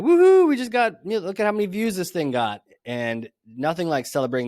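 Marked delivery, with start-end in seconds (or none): 0.0-2.6 high, loud woohoo; mock celebration. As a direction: cheer like a giddy marketer. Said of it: "we're like".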